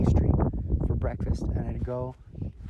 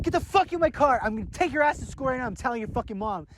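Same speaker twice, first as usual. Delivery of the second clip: mock angry uncle; 8 semitones higher than usual. Uncle orders truant nephew into the truck, mock angry. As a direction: bark it half-mockingly like a fed-up uncle.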